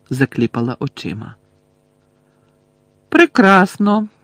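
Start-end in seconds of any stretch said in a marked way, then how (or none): 3.1-4.1 cold reply. A narrator's reading. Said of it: "холодно озвалася вона".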